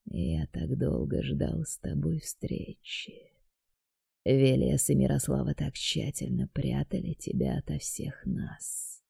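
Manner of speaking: quiet sinister deity introducing himself; dark god voice. A narrator's reading.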